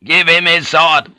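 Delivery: high, loud, furious order; forceful angry command; shouted. A narrator's reading. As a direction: shout the demand impatiently. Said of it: "turned to Blackthorn and shouted".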